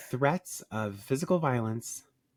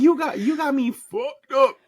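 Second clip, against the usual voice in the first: much higher and louder, sassy disbelief; indignant outburst; 13 semitones higher, 6 dB louder than usual. Acted. To her co-host, indignant and shrill-high. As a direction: fire it back in outrage.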